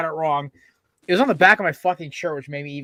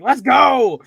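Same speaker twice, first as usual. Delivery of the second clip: loud, higher hyped-up yell; imagined cheer; 6 semitones higher, 8 dB louder than usual. Pretend. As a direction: shout it like a fired-up fan.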